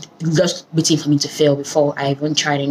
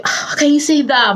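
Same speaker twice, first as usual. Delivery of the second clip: shocked mom; 8 semitones higher than usual. Retold or imitated. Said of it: "My mom was like".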